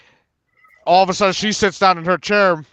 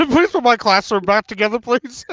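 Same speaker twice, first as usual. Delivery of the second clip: higher-pitched echo through laughter; mock pleading; 6 semitones higher than usual. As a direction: imitate a teacher's plea, half laughing. Laughingly echoes the teacher's plea.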